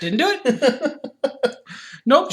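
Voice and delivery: slow, drawn-out mock Clinton denial; imitating a president's famous denial, slow; Bill Clinton denial. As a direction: deny it slowly like a politician. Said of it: "absolutely Bill Clinton".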